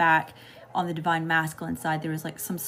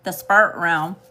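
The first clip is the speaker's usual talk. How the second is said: southern accent slip; 9 dB louder than usual. Real, not acted. Reader noticing her own southern accent on "spirit". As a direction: let the southern accent take "spirit" as "sprt". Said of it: "y'all hear my southern accent coming out".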